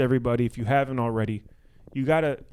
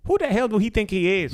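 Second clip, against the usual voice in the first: higher-pitched mock indignant critic; offended stranger; 5 semitones higher than usual. Imitating someone who doesn't know him, mock offended. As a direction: imitate an affronted online commenter. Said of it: "that's gonna be like".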